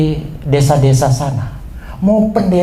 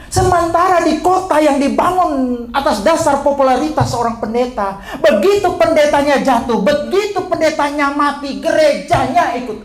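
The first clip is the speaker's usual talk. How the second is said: fervent preaching; 11 semitones higher than usual. Acted. Preaching at full force.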